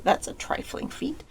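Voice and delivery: lofty, slow and dismissive; a proud spirit's lofty scoff; haughty. A narrator's reading. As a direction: brush it off as beneath him. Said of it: "The spirit said haughtily".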